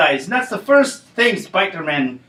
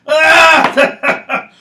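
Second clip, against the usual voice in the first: loud laughing burst; 9 semitones higher, 6 dB louder than usual. Acted.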